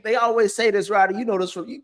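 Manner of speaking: normal talking voice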